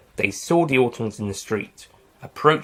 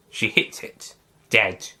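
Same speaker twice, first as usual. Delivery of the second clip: usual speaking voice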